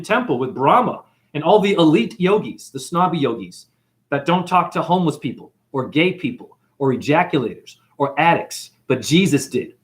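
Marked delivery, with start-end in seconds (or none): none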